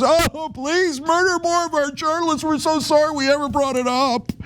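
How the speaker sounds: high, simpering sarcastic grovel; mock a servile lackey begging sweetly. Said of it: "The American government grovels to Israel".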